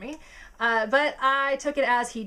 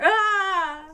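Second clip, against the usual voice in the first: high, loud flustered ah; exasperated cry; 9 semitones higher, 7 dB louder than usual. Retold or imitated. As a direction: groan it out overwhelmed. Outburst after quoted reaction.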